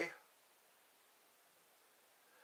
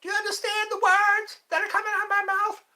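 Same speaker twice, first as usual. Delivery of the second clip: very high, loud, fast movie-star impression; Chris Tucker; 5 dB louder, faster than usual. Comedian demanding to be understood, high-pitched shouted impression. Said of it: "Thoughts on Chris Tucker?".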